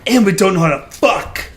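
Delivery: "fuck" set apart and hit hard; stress on fuck. Retold or imitated. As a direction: imitate her heavy emphasis on "fuck". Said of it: "she really put the emphasis on fuck".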